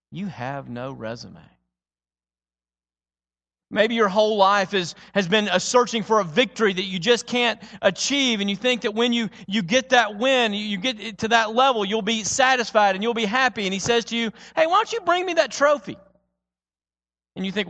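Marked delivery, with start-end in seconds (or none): 0.1-1.4 quiet. Acted. Jesus voiced softly. 14.6-16.0 playful. Acted. Jesus voiced playfully.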